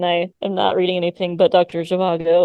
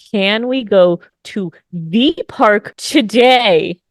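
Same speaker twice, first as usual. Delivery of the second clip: very slow, every word spelled out; slow enunciated repeat; 4 dB louder, much slower than usual. Retold or imitated. Daughter repeating her question to mom, slowly enunciated. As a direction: drag it out like talking to someone dumb. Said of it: "she'll just say it again slower like I am too dumb".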